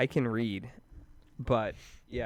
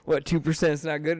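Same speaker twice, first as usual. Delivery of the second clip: mock teasing; 5 semitones higher, 6 dB louder than usual. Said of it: "obviously joking".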